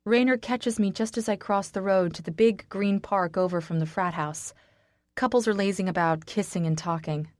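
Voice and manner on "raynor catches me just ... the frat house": ordinary delivery, nothing marked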